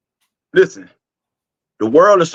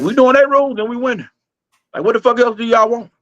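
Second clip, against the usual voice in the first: high-pitched, exasperated protest; 8 semitones higher, faster than usual. Real, not acted. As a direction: argue it in a higher voice, fed up. Arguing the US still wins.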